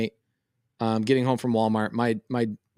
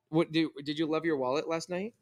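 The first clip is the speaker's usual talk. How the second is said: gentle coaxing; 2 semitones higher, 8 dB quieter than usual. Real, not acted. Dad asks daughter about her wallet, soft coaxing. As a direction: quietly prompt a little one to answer. Coaxes shy small daughter off-story.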